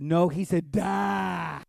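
slow, loud, stubborn correction; father mocking a stickler, slow stubborn shout; insistent shout. Slow loud mock insistence. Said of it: "And he said".

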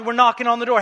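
normal talking voice

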